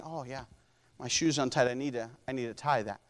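meek, humble voice. Retold or imitated. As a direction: mumble it like a humbled child. Humble person voiced, accepting correction.

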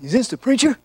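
high, hammed-up caller voice; a prank caller faking it on the phone. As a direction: put on a goofy caller. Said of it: "a guy called me, and he said".

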